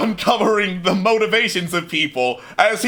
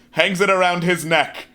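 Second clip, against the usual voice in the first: ordinary talk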